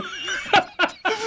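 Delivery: high squealing laughter; high shrieking laugh. Real, not acted. Laughing at the sweating mate with a high shriek.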